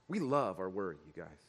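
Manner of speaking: normal talking voice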